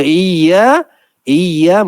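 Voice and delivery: "iya" without the doubled y; wrong iya. Shown as a demonstration. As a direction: say "iya" light, no stress. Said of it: "makna ke atas engkau lah".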